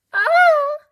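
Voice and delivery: very high, loud shocked yell; granny startled out of her wits; high startled scream. A narrator's reading. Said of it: "yelled Granny".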